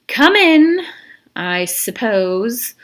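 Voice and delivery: high, loud, drawn-out "come in"; cool grudging welcome; warden's voice. Acted. Warden to Stanley at the door, grudging and drawn out. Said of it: "said the warden".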